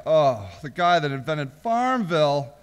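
ordinary talk, nothing marked

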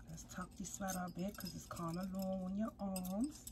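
whispered while tucking the sweater; soft unvoiced whisper to the doll. Real, not acted. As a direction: breathe the words, no voice. Dressing the doll.

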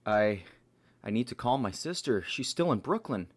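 loud, alarmed outburst; panicked. Acted. Friend frantic to reach a sister in Brooklyn, panicked. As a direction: blurt it out loudly in mounting panic.

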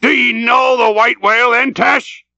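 loud, very high excited question; fevered captain's shout; urgent demand. Acted. Ahab seizes on the whale's name.

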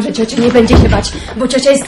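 ordinary talk

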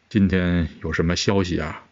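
low, soft emperor's voice. A narrator's reading. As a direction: question the eunuch in a low, quiet royal tone.

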